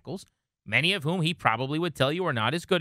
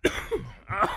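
sudden rough coughing fit; hacking cough. Real, not acted. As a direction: cough hard. Coughing fit after laughing.

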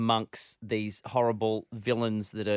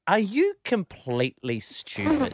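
scornful; 9 semitones higher, 5 dB louder than usual. Retold or imitated. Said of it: "she gives adults this look like".

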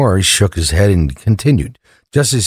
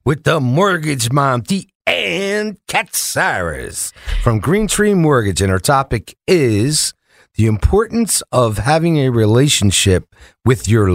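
slow, higher-pitched showman's introduction; segment announcer; 5 semitones higher, slower than usual. Acted. Host introducing the mortgage mom, drawn-out announcer style. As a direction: stretch out the host's name like a ringside introduction.

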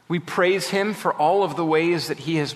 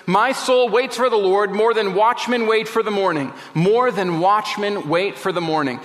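higher-pitched, pleading recitation; psalmist's deep plea; 5 semitones higher than usual. Acted. Preacher voicing the psalmist's longing for the Lord, pleading. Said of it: "the depth of the plea of the psalmist".

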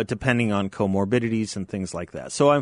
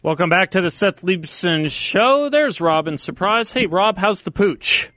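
upbeat, higher radio-host welcome; 9 semitones higher, 4 dB louder than usual. Acted. Host welcomes listeners back after the break, upbeat and higher.

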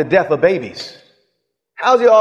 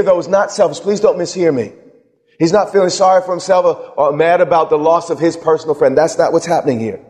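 ordinary talk, nothing marked

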